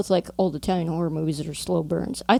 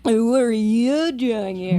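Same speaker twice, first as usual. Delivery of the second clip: movie quote; 3 semitones higher, slower than usual. Pretend. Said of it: "I think your turn now".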